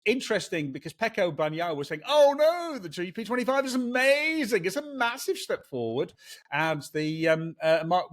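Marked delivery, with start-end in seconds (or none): 2.1-6.1 high, hammy panto voice; broad singsong kids theatre; pantomime voice. Retold or imitated. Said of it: "that pantomime voice".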